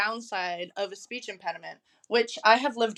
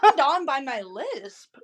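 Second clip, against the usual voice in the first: laughing; 4 semitones higher, 4 dB louder, slower than usual. Real, not acted. Incredulous laughing question.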